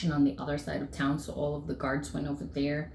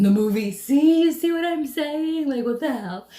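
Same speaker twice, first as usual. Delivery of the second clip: loud, high disbelief; amazed; 8 semitones higher, 7 dB louder than usual. Real, not acted. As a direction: push it up, stunned. Amazed at dream ending.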